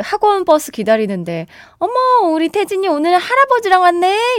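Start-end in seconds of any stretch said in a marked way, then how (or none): 1.8-4.4 높은 선생님 말투 흉내; 반가운 듯 높게 흉내 낸 유치원 선생님; teacher's voice. Retold or imitated. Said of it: "이렇게 하는데". (유치원 선생님 흉내, 높게)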